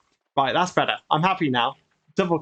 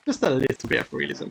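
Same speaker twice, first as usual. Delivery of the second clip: normal talking voice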